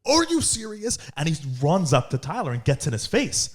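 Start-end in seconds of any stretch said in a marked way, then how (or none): 0.1-1.0 mock outrage. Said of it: "the guy was like".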